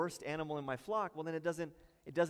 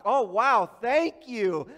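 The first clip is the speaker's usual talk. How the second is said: mock gratitude; 5 semitones higher, 12 dB louder, slower than usual. Governor mocks diseased gift.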